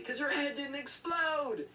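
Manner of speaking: high, drawn-out complaint; hammed-up high-pitched grievance; mock exasperation. Acted complaint to classmate, pitch leaps.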